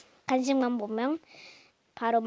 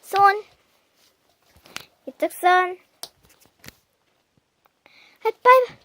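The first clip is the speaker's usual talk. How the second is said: loud dog commands; 5 semitones higher, 7 dB louder than usual. Real, not acted.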